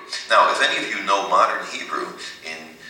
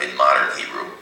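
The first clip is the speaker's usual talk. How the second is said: usual speaking voice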